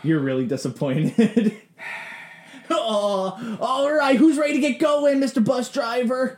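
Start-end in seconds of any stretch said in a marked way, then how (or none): 2.7-6.4 Gary's voice. Acted. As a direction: sing out to the bus driver. GM voices Gary calling out.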